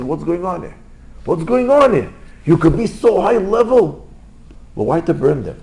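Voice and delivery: high-pitched acted disbelief, rising in volume; indignant rebuke. Rabbi seeing people busy with work, indignant rebuke.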